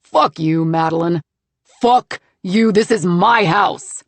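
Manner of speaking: loud, slow furious snarl; enraged husband snarling at his wife. Acted. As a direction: spit the words at her. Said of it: "he snarls".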